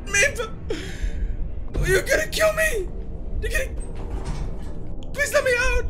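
high loud desperate begging; frantic pleading. Real, not acted. Gamer trapped by monster, begging frantically to get out. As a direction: plead to escape, near tears.

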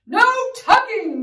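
loud, high crew chant; crying out. Acted. Crew backs the captain's bedtime refusal, crying out. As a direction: cry it out in agreement. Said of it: "the crew cried".

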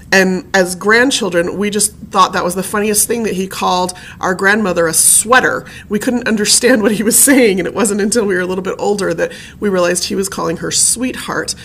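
normal talking voice

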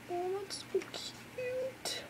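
high slow coo; melting coo. Real, not acted. Fan cooing over an adorable photo.

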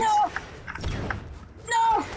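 high frantic gamer cries; panicked yelling. Real, not acted. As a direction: panic as you lose the fight.

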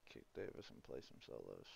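low conspiratorial whisper. Real, not acted. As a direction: whisper it like a sneaky joke. Joking aside about kicking Davis.

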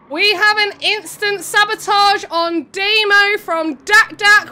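loud, high, drawn-out sabotage announcement; announcer call. Real, not acted. Streamer announces an instant sabotage on a racer, loud announcer call. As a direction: proclaim it like a big game event.

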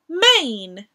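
usual speaking voice

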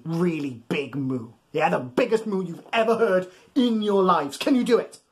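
ordinary delivery

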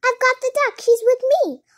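high, loud announcement; excited shout. A narrator's reading. Dodsworth telling Hodges the duck is found, shouted. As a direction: shout the good news. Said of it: "shouted Dodsworth".

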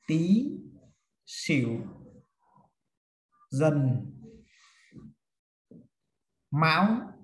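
one word at a time, long pauses between; slow dictation. Shown as a demonstration. Teacher dictating the fixed order to a class, word by word. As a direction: dictate the list word by word, leaving time to write.

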